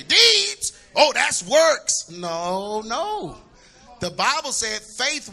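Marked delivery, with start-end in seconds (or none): 0.0-2.1 mock objection. Voicing a doubting churchgoer.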